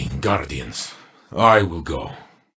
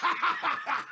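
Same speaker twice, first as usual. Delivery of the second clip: big laugh, high; triumphant warrior laugh; booming battle laugh; 11 dB quieter than usual. Acted. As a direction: laugh out in joy of the fight.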